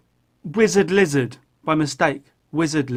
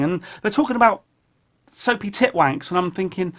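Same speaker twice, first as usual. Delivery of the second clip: usual speaking voice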